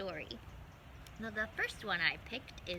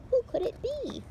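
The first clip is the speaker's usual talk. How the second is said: curious little mouse; 8 dB louder than usual. Acted. Mouse wonders aloud.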